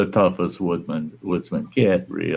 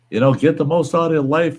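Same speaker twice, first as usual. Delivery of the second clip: higher-pitched mock urging; imitating preachy atheist; 8 semitones higher than usual. Atheist lecturing him on life, mock-insistent. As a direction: mimic his insistent advice in a raised voice.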